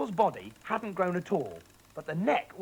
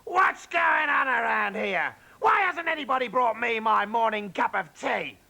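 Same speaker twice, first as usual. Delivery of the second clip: high, loud indignant tirade; louder, higher grumbling shout; 7 semitones higher, 5 dB louder than usual. Acted. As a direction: demand breakfast at the top of her voice. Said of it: "and she shouted".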